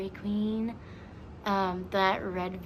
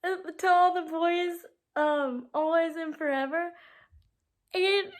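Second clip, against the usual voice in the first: higher, louder, half laughing half crying; laughing through tears; 9 semitones higher, 4 dB louder than usual. Real, not acted. Telling viewers about the movie, laughing through tears. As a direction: giggle helplessly while teary.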